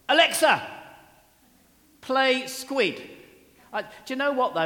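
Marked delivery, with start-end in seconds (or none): 0.1-3.0 loud, high call to the smart speaker; loud voice command to Alexa. Retold or imitated. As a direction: bark it at the device across the room. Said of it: "and I say".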